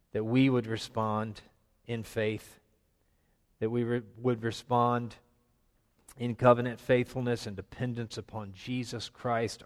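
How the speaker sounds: soft, quiet prayer voice. Real, not acted. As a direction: pray softly and humbly. Leads a closing prayer.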